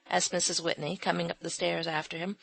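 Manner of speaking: ordinary delivery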